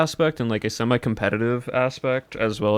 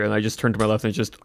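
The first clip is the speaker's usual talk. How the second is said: normal talking voice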